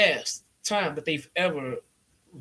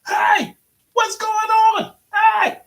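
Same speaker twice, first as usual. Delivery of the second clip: much higher, louder mock yelling; imitating J R furious; 6 dB louder, slower than usual. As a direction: screech it like an outraged commentator. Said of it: "J R is fucking pissed".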